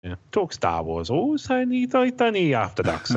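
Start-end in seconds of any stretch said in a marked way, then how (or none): 1.1-3.2 silly put-on voice.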